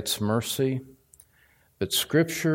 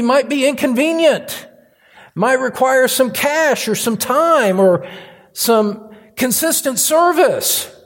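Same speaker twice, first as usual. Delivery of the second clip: high, loud pulpit preaching; fired-up sermon delivery; full-force preaching; 8 semitones higher, 6 dB louder than usual. Acted. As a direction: preach it out to the congregation.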